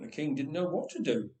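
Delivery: ordinary delivery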